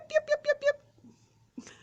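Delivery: high, loud yips; little dog yip. Shown as a demonstration. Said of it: "some dogs have a little yip".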